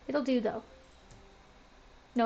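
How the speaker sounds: usual speaking voice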